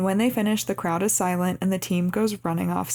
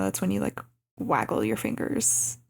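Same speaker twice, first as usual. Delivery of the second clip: normal talking voice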